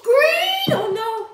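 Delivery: high, loud cry of alarm; shocked scream. Real, not acted. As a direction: yelp it in sudden shock. Breaks the pop socket.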